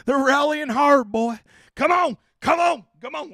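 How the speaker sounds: rally them on, come on come on; excited cheering. Real, not acted. Streamer hypes chat toward a hundred likes, excited and loud.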